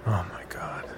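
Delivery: hushed sympathy; shocked whisper. A narrator's reading. Reaction to suicide news.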